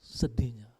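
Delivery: breathy whisper of sorrow. Acted. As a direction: let the word fade to a whisper. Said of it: "Menangis dengan se".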